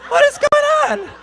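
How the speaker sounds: very high, drawn-out bewildered squeak; a falsetto of utter disbelief; baffled squeak. Retold or imitated. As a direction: squeal it in total confusion. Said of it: "And I'm like".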